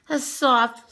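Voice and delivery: plain reading voice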